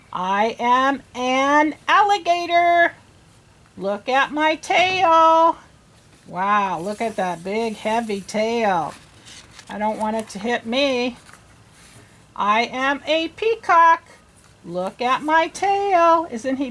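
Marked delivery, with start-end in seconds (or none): 0.1-5.6 slow, higher proud animal voice; an alligator showing off its tail. Acted. Teacher voicing the alligator for kids, slow and higher. 12.4-16.3 higher, slow, proud animal voice; a peacock showing off its feathers. Acted. Teacher voicing the peacock for kids, higher and slow.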